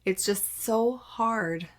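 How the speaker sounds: ordinary delivery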